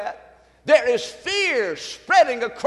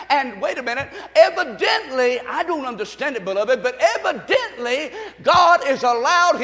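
louder, higher fiery preaching; full-force preaching; 5 semitones higher, 4 dB louder than usual. Acted. Preacher warning the church about the president, fiery. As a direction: let it rise to a shout.

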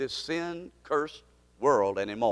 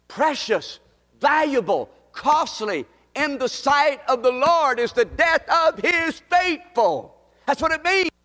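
preaching; 8 semitones higher than usual. Acted. Preacher building on the verse.

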